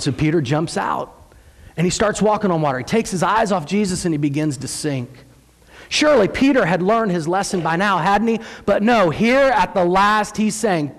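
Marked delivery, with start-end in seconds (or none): none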